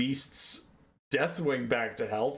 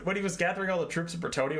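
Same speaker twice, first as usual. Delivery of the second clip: ordinary talk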